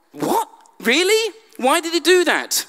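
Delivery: high, incredulous what-really; congregation's mock incredulity at Peter; baffled disbelief. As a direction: act out baffled readers. Listeners' baffled reaction.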